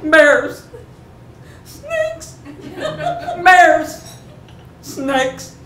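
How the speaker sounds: high, urgent one-word cries; alarmed warning calls. Retold or imitated. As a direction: call out each danger sharply. Calls warnings over the barking dog.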